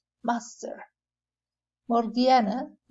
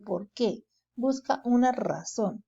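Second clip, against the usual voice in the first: ordinary delivery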